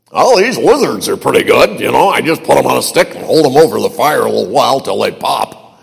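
lightly voiced salty old cowboy; a hint of a drawling old cowboy. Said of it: "some salty old cowboy".

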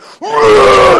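sudden high shouted cry; explosive mock battle cry; wild yell. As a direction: burst out like a man charged with adrenaline. Dad's crazy adrenaline burst.

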